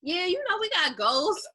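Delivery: high mock casual voice; mocking imitation. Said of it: "you just be like".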